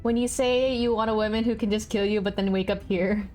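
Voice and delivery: usual speaking voice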